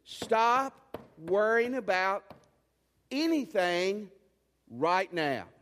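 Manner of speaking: slow, loud, urgent command; slow and forceful, a command that must stop now; power and urgency. Shown as a demonstration. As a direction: drive each word home like an order from God. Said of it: "with power and urgency".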